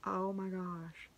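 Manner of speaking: hushed, slow oh my gosh; close to tears. Real, not acted. Moved by the yarn gift, hushed and near tears. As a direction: barely get the words out, overcome. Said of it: "I'm about to cry now".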